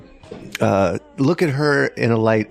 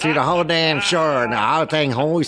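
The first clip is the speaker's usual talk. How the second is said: mocking drawling girl impression; 6 semitones higher than usual. Host mocking a show character to co-hosts, exaggerated twang. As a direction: put on an irritated girl's twang. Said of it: "starts off with her going".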